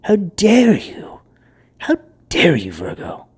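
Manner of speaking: slow, outraged whisper; mock-scandalised hushed scolding; indignant whisper. Indignant whisper at draining energies.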